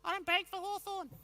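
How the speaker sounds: put-on a small boy mumbling back, pitched high; little kid. Editor hears the kid refuse, high child voice. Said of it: "Little kid says".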